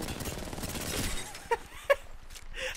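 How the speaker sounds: high breathy cackle; gleeful laugh. Real, not acted. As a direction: laugh wickedly at them. Laughing at a fleeing enemy.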